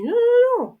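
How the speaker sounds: high, loud retold no, no, no; emphatic refusal. Telling mum she'll plant alone, high emphatic no.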